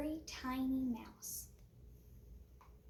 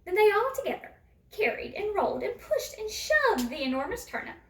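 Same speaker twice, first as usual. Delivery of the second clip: higher, louder, laughing list of actions; excited rush; 7 semitones higher, 5 dB louder than usual. A narrator's reading. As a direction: tumble through it gleefully. Joyful rolling of turnip.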